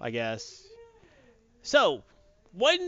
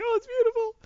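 mock tearful, very high voice; Odin; faster than usual. Retelling Odin moved by the play, mock crying. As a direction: pretend to weep at something beautiful. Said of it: "Odin's, like, crying".

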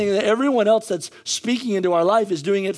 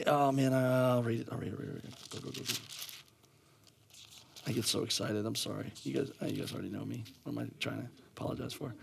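very low, hushed self-talk; muttered aside; 8 semitones lower, 13 dB quieter, faster than usual. Real, not acted. Preacher apologizing to church for getting excited, muttered aside. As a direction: drop right down and mumble to yourself. Embarrassed aside while finding the passage.

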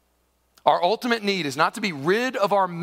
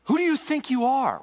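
higher, indignant challenge; outraged; 7 semitones higher than usual. Acted. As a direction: demand it like an affronted scribe. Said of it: "They were outraged".

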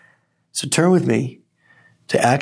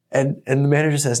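usual speaking voice

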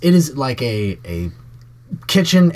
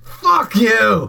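gruff higher angry shout; angry snap; 8 semitones higher than usual. Acted. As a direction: snap back at them. Thug NPC curses back.

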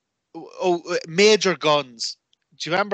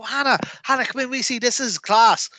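calling out excitedly; 9 semitones higher than usual. Retold or imitated. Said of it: "I'd shout into my girlfriend".

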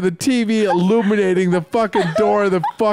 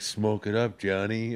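lower, much softer coaxing offer; 7 semitones lower, 10 dB quieter than usual. Retold or imitated. Older brother offering a joint, low and soft. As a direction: murmur the invitation low. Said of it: "he'd be like".

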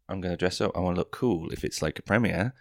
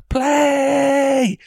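long loud high shout of the word; booming drawn-out verdict; 12 dB louder than usual. Acted. Host shouting the verdict over a cheering crowd, long and loud. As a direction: hold it out like a stadium announcer.

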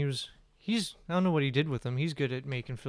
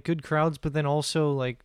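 usual speaking voice

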